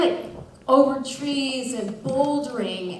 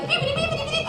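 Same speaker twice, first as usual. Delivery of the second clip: very high squeaky cartoon beeps; cartoon legs noise; much faster than usual. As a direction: make your legs sound like a cartoon. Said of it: "I look like a cartoon character".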